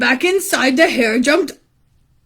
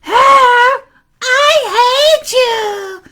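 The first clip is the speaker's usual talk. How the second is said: very high, loud, drawn-out shriek; lady's angry yell; 11 semitones higher, 4 dB louder, much slower than usual. Acted. Lady yelling at hair on tummy, high angry shriek. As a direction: shout it high and stretch the end. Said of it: "The lady yelled".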